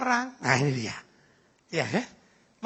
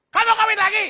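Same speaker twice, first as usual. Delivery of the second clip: mock outraged high outburst; indignant wife; 9 semitones higher, 4 dB louder than usual. Angry wife challenges husband about remarrying, mock indignant. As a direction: fire it back high and loud, in pretend alarm. Acted wife's alarmed retort to husband.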